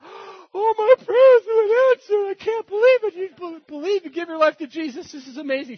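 high, loud mock weeping with joy; imitated tearful outburst. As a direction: wail it like a hammed-up crying convert. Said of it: "She bursts into tears".

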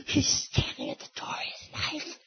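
high, whispered in panic; terrified whisper. Retold or imitated. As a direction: whisper in terror about an intruder. Wife's night terror, acted.

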